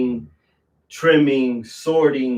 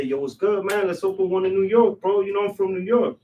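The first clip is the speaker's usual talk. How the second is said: put-on casual New York street accent, pitched higher, like asking a buddy; New York accent, easygoing and bold, a bit higher; his own New York accent; 8 semitones higher than usual. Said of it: "in that same accent".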